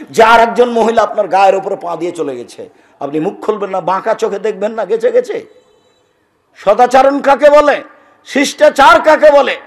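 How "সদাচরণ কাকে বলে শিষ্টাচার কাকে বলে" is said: loud, high fiery sermon cry; thundering preacher's climax; preaching at full force. Acted. Rhetorical questions to crowd.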